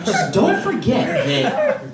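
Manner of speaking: usual speaking voice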